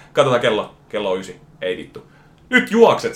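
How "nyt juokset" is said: high, loud urgent call; shout. Retold or imitated. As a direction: yell it across the bar. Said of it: "se huutaa se baarimikko". (kova, korkea huuto)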